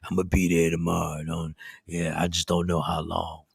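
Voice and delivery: low, soft, fast doped-up mumble; groggy drugged voice. Retold or imitated. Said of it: "this is the voice".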